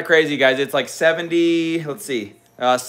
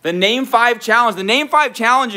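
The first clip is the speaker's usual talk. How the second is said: hyped game announcement; 7 semitones higher, 4 dB louder than usual. Acted. Hyping the game to viewers.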